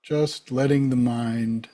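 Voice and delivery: calm, unhurried meditation guide with long pauses; spacious, settled instruction; guided meditation. Real, not acted. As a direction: lead them gently into the breath, leaving space between phrases.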